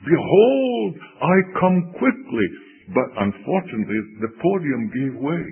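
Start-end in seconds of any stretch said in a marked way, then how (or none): 0.1-2.5 imitated preacher's shout, very high and loud; earnest shout. Blanked young preacher's third try at his text, shouting out. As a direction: shout the verse out with desperate earnestness. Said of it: "and he shouted out".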